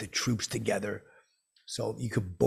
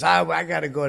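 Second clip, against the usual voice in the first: slightly raised, louder imitation; loud, higher retold excuse; harried star; 7 semitones higher, 8 dB louder than usual. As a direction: act out someone trying to get away. Star escaping him, acted out.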